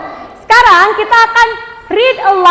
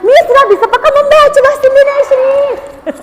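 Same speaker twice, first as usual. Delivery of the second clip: put-on higher, whining child's protest; whiny pupil; 7 semitones higher than usual. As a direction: complain like a pupil who can't read. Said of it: "Ada yang begitu?". (suara anak merengek, lebih tinggi)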